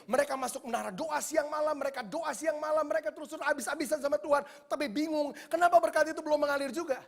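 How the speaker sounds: loud, high, fervent preaching; preaching at full force. Acted. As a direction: hammer the warning to the church. (berkhotbah keras dengan nada tinggi)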